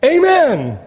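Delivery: high, emphatic amen; a fired-up preacher's amen. Real, not acted. Preacher affirming his own point, fired up. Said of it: "Sorry, I'm fired up".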